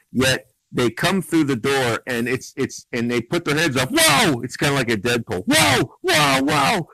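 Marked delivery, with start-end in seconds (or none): 3.9-4.4 exclamación burlona aguda y fuerte; high loud mocking whoa. Mocking characters' cheerful reaction. 5.5-6.9 exclamación burlona aguda y fuerte; high loud mocking whoa. Mocking characters' cheerful reaction.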